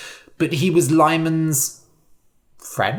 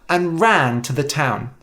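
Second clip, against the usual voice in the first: plain reading voice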